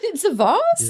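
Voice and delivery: laughing it out, high and slow; a giggly punchline repeat. Real, not acted. Laughing as she repeats the joke to co-hosts.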